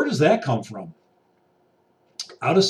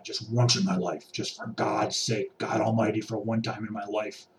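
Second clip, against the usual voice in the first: weary quiet plea; 6 semitones lower, 6 dB quieter than usual. Retold or imitated.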